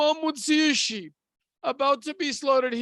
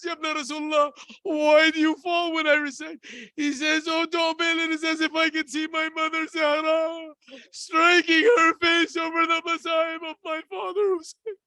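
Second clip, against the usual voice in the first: high, tearful cry at the height of mourning; wailing lament; 4 semitones higher than usual. Retold or imitated. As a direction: let grief carry the voice up into weeping. Climax of mourning sermon.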